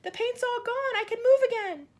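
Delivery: high, loud gleeful shout; the freed centipede shouts to the others; excited shout. A narrator's reading. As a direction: shout it out in delight. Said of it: "shouted the centipede excitedly".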